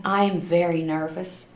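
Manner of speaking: ordinary delivery, nothing marked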